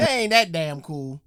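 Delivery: a loud, scoffing put-down. Real, not acted. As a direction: call it out loudly, unimpressed. Scoffing reaction to the band names.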